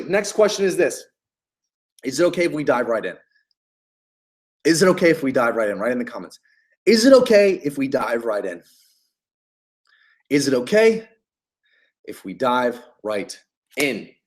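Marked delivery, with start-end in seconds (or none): 2.0-3.2 quick ask. Shown as a demonstration. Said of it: "That's what you say". 4.6-5.8 quick ask. Shown as a demonstration. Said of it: "That's what you say". 10.3-14.1 paused ask. Shown as a demonstration. Coach shows a sales opener, split with a pause and trailing softer. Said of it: "That's what you say".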